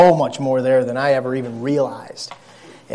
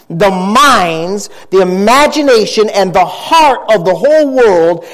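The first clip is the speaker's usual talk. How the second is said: raised, emphatic pulpit voice; forceful preaching; 7 semitones higher, 6 dB louder, slower than usual. Real, not acted. Preacher warns his church about the weakness of the mind, preaching hard. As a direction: drive each point home to the congregation.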